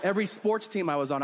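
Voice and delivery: usual speaking voice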